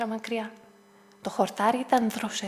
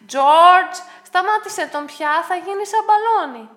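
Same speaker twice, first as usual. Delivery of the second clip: loud, higher call; 7 semitones higher, 10 dB louder, slower than usual. A narrator's reading. Someone warning George about the dog, loud call. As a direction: shout a warning across to George.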